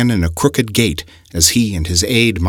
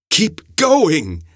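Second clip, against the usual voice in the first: prodding; 12 semitones higher than usual. A narrator's reading. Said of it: "the old man prodded".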